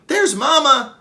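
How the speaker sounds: high, loud happy cry; excited cry. Acted. Little gorilla Kudzu crying out on seeing her mother. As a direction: spot mother and call out. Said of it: "Kudzu cries".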